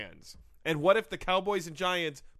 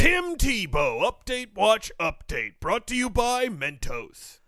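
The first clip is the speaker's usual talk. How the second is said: slow, loud, raised announcer voice; mock segment announcer; 3 semitones higher, 5 dB louder, slower than usual.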